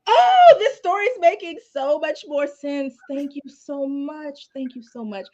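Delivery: high, drawn-out gush that softens into thanks; relieved coworker. Retold or imitated. Young prosecutor thanking her translator, high and gushing. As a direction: imitate gushing relief, loud high "oh" fading to slow gratitude. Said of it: "And then she's like".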